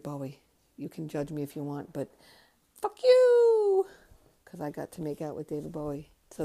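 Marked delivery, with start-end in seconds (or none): 2.8-3.9 squeaky much higher, louder taunt; playful defiance. Real, not acted. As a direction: fling it at the critics in a silly high voice. Defiant jab at judging viewers.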